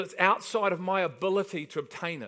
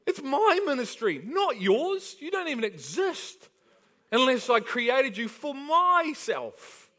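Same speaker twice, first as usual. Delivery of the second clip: raised, firm voice of God answering; God rebuking the leaders, loud and high; voicing God; 5 semitones higher, 4 dB louder than usual. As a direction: declare it as God, forceful and higher. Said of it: "God's going".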